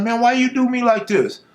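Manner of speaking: high pleading whine; whiny junkie. Retold or imitated. Junkie whining at speaker.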